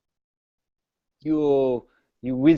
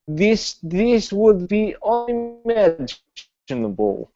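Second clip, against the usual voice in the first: lightly voiced foreign knight; 7 semitones higher than usual. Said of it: "he says".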